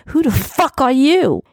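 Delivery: high, angry demand; spitting anger. A narrator's reading. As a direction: spit the question out furiously. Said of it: "as he spat at me".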